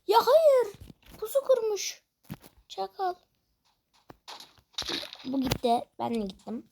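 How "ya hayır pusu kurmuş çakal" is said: dismayed cry. Real, not acted. Gamer ambushed by an enemy, crying out in dismay. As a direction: wail it as you get ambushed.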